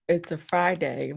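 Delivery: ordinary talk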